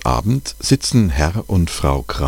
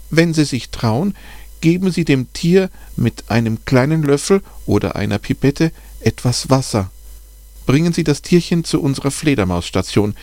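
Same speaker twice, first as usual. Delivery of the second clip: higher, careful voice of the bat expert; the expert on the phone, voiced higher, patiently explaining; kind expert's advice; 8 semitones higher than usual. A narrator's reading. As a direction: talk the caller through it step by step, kindly. Expert continues her advice.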